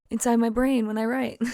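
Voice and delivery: ordinary delivery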